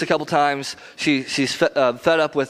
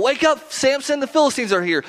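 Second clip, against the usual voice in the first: much higher mock yell of alarm; yelling warning; 10 semitones higher than usual. Delilah yelling to wake Samson, high mock alarm. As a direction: call out to wake him, pitched way up. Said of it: "she would yell".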